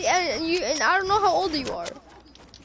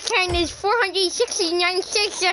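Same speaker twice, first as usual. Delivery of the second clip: exaggerated slushy-mouthed mimicry; mock him with mushy, hissing consonants; hammed-up imitation of odd speech; mocking impression; 4 semitones higher than usual. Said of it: "Like, you're like".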